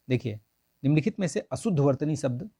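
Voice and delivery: normal talking voice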